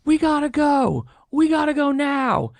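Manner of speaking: high, loud acted yelling; urgent yell. Alexis warns the party to get out, high yelling. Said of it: "Alexis start, like, yelling".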